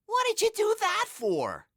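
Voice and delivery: very high, squawking protest; outraged cry. A narrator's reading. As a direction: cry out in high-pitched disbelief. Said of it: "cried Harold".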